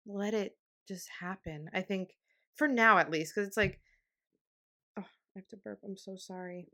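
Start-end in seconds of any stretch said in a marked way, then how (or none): 5.0-6.7 much softer, apologetic aside; hushed apology. Real, not acted. Podcaster apologising to listeners for burp, hushed. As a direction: drop the volume and excuse yourself.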